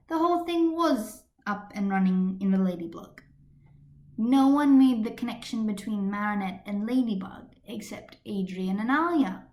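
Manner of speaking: ordinary delivery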